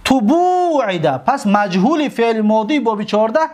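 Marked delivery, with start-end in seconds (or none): 0.0-1.2 تبوعده drawn out: tu-bu-i-da; slow model answer; slow model word. Shown as a demonstration. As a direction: dictate تبوعده slowly with the u sounds. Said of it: "پس در اینجا این واو اصلش چیه؟".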